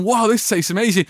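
high, impressed mock exclamation; amazed diners. Everyone raving about the food, mock amazement. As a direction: play the wowed customer. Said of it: "And everyone's going".

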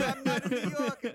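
high, laughing mock New Yorker accent; jokey put-on New York accent through a laugh. Guest denying he is a New Yorker, in mock New York accent. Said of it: "but I don't speak like that".

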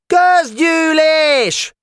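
very high, stretched-out screech; squawking demand across the yard; screeching shout. A narrator's reading. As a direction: yell it shrilly, dragging each syllable. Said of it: "rikácsoló hangon kiabált".

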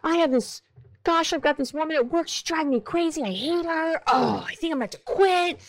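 high, frantic venting near tears; mock the partner blowing up about work; hammed-up overwhelmed rant about a coworker; upset partner venting. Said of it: "your partner's coming at you with".

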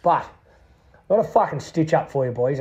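usual speaking voice

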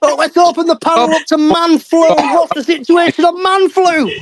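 high, hammed-up presenter voice; mock talk show host. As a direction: announce it like a grand panel host. Mocks host's open-the-panel line.

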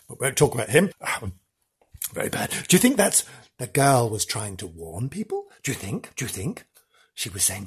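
normal talking voice

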